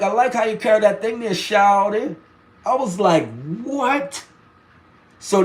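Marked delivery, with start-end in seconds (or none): 0.0-2.1 quick, higher imitated Southern voice; down south accent. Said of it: "introductions to a down south accent". 3.6-4.2 high, drawn-out mock disbelief; baffled surprise. Retells own baffled reaction.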